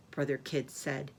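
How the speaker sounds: ordinary delivery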